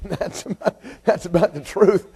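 talking through a laugh. Real, not acted. Preacher capping his joke to the congregation, laughing. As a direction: chuckle through the words after your own punchline.

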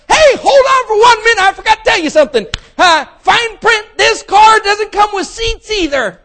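very high, very loud running shout; salesman yelling. Acted. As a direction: bellow across the lot at him.

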